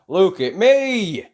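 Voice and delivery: slow, loud, breathless call; gasped. A narrator's reading. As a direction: gasp it out mid-stroke. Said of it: "he gasped".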